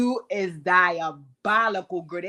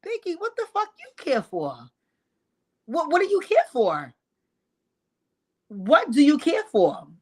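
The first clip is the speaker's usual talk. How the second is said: high-pitched, incredulous challenge; exasperated questioning; 7 semitones higher, 4 dB louder than usual. Pretend. Host challenging Biggie over what she cares, exasperated. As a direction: press the question with mounting exasperation.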